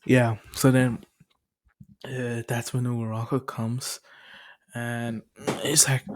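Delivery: ordinary talk, nothing marked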